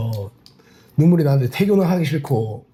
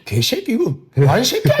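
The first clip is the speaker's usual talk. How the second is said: higher, slower mimicked cursing, half laughing; tough drill instructor; 6 semitones higher than usual. Instructor cursing a singled-out trainee, tough mimicked voice. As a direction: imitate an instructor laying into a trainee. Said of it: "그 말투도 막 빡세잖아요".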